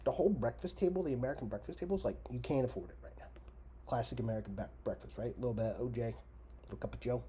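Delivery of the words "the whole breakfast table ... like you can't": higher, louder rant; ranting outburst. Real, not acted. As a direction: burst out indignant.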